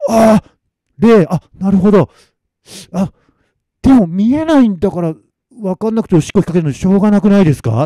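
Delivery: high, loud plaintive protest; sudden understanding turning into a pleading objection; realising, then arguing. Acted. Masamitsu arguing back at the weasel spirit, protesting.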